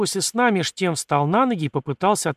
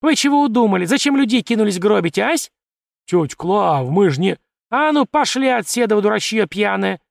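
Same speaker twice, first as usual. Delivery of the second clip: loud, higher scolding yell; 6 semitones higher, 5 dB louder than usual. A narrator's reading. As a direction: scream at the drunk crowd. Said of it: "продолжала орать тётка".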